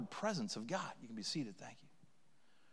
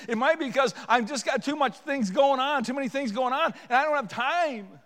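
loud, high, driving confession; fervent, relentless pulpit delivery; impassioned preaching; 11 semitones higher, 9 dB louder than usual. Real, not acted. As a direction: preach with mounting urgency to a cry. Preaches at full force.

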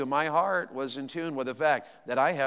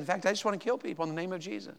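quick, soft imitation of the wife; mock confession; 3 semitones higher, 7 dB quieter, faster than usual. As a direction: toss it off quickly, imitating her. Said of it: "she didn't say it like that".

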